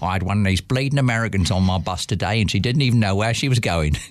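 lightly done London cabbie, grumbling; London accent. Retold or imitated. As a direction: grumble it like a London cabbie telling his wife. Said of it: "going home that evening to his wife saying".

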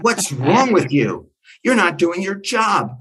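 acted indignant yell without much extra volume; retold screaming. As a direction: scold a stranger down the phone. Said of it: "I started screaming at him".

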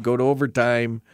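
normal talking voice

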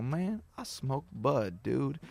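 slow, soft laid-back voice; mock laid-back stoner to himself while peeing; put-on dude voice. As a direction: drawl it like a stoner.

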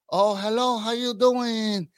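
drawn-out mock Vietnamese accent; slow put-on Vietnamese accent; imitated Vietnamese accent. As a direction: greet like a Vietnamese waiter, stretching the last word. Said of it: "But imagine being like".

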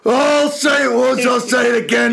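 high, hammed-up shouted warning, slowing down; mock teacher outburst. No-nonsense teacher to a little kid, mock furious shout. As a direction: build a slow, shouted telling-off, drawing out the last words.

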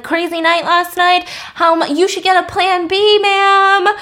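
high, jeering mock catcall; imitated catcalling. Construction dudes to woman walking home, mock catcalling. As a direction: call it out high, hammed-up. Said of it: "construction dudes who are catcalling her".

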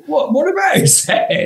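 incredulous question. Retold or imitated. Band asking about the meeting.

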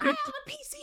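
put-on tiny, very high kid voice; little kid. Kid answers the creepy stranger, high squeaky mock voice. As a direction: imitate a small kid piping up softly. Scared kid's voice, retold.

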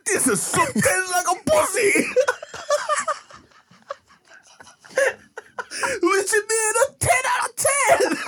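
high voice breaking into laughter; laughing mock review. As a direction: squeal it while laughing.

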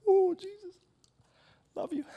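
tiny high-pitched mock-adoring whimper; mock dainty weeping. Preacher mocking a little show of devotion, mock dainty weeping. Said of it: "this is not like a little".

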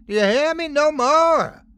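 high, loud demand; enraged mother shouting; furious shout. A narrator's reading. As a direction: yell it high, brooking no argument. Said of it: "Esme shouted".

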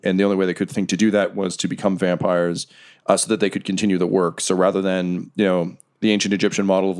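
ordinary talk, nothing marked

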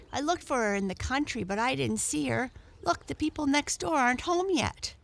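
put-on high-pitched boy talking eagerly; boy's voice. A narrator's reading. Boy replies in the story.

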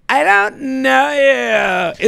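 a hammed-up snooty dismissal; mocking Victoria. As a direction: drag it out like a snooty brush-off. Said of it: "is the least confident person".

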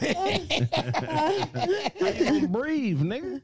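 high, slow laughing question; laughing disbelief. Real, not acted. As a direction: laugh through it.

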